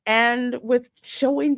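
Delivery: ordinary talk, nothing marked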